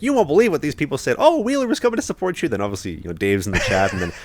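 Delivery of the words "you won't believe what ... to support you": lightly voiced mom, pitched much higher; imitating mom. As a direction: mimic his mother gossiping over text, high and bubbly. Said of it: "She's like".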